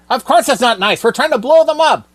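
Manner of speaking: a fed-up villain losing patience; exasperated villain. Acted. Villain scolds dim henchman.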